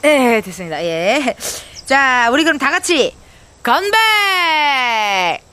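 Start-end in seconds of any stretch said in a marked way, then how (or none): 3.6-5.4 cheer. Real, not acted. Toasting together with makgeolli.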